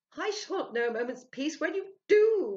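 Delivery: high, loud wail; a wailing cry of fear. A narrator's reading. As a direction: moan it out in dread. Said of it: "wailed Sylvia".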